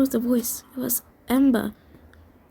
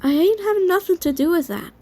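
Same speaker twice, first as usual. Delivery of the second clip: higher-pitched, lightly acted retort; spitting denial; 6 semitones higher, 4 dB louder than usual. A narrator's reading. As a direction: spit it out in mild defiance. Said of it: "Talon spat, slurring her voice again".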